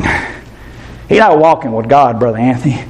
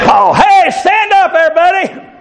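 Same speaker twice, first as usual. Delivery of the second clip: Joe Biden; slower than usual. Retold or imitated. Preacher mocking Joe Biden calling up senators. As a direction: shout names like a politician. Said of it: "that's Joe Biden".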